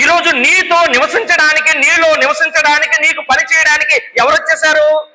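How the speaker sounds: high, sustained exhortation; impassioned preaching. Real, not acted. Preacher telling believers who came to dwell within, high fervent cry. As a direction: drive it home on a high pitch.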